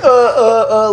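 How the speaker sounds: high, loud mock fumbling; acting out being overwhelmed and lost for words; flustered fumbling. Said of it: "like, fumbling".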